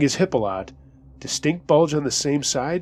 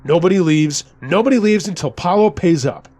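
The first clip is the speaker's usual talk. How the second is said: loud, higher-pitched gunman's proclamation; almost laughing announcement; 4 semitones higher, 5 dB louder than usual. A narrator's reading. Gunman holding the office hostage, almost laughing announcement. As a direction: announce it loudly, on the edge of a laugh. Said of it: "He announced it almost laughing".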